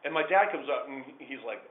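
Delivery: normal talking voice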